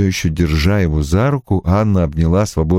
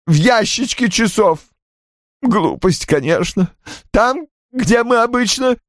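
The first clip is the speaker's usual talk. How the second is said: high, halting voice close to breaking into sobs; moaning, tearful confession; 11 semitones higher than usual. A narrator's reading. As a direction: confess haltingly through mounting tears. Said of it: "Рой захлебнулся рыданиями". (покаянно, высоким плачущим голосом, с паузами)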